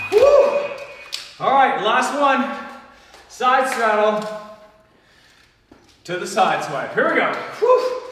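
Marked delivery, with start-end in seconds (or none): 0.1-0.7 loud high woo; excited cheer. Real, not acted. Coach cheering mid workout. 7.6-8.0 high loud woo; excited cheer. Real, not acted. Coach cheering mid workout.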